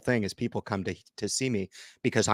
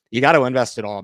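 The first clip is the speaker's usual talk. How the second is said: louder, pushing hard on gotta; louder insistent repeat; 3 semitones higher, 8 dB louder, faster than usual. Retold or imitated. Mocking critics, he repeats their advice louder. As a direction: hammer the advice home, louder.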